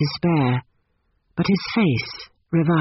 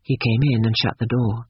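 ordinary delivery

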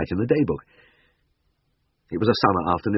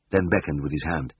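ordinary delivery, nothing marked

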